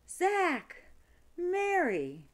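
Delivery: very high, loud cry of each name; thrilled lovers calling to each other; excited greeting. A narrator's reading. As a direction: call out in delighted surprise. Lovers reunite, calling names.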